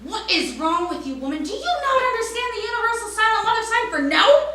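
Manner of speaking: high, loud exasperated scolding; exasperated mother. Retold or imitated. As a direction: glare and scold her in disbelief. Mother's glaring silent rebuke.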